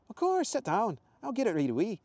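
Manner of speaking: high, loud Scottish woman; Scottish accent. Acted. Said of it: "much softer Scottish accent".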